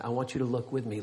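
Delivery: soft, low call for attention; parent-like hush. Retold or imitated. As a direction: quietly draw a child's attention to you. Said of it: "It's kinda what God's doing".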